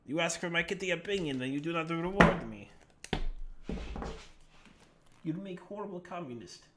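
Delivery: a hint of a Russian accent, quick then fading quiet; playful Russian cat grumbling at its owner; lightly voiced Russian cat. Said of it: "I don't know why I made my cat Russian".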